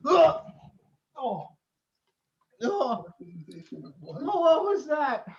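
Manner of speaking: ordinary delivery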